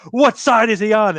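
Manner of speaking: high, urgent wrestling-commentator call; excited announcer. Retold or imitated. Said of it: "he goes".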